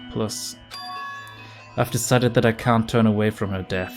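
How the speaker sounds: ordinary talk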